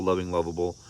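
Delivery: ordinary delivery, nothing marked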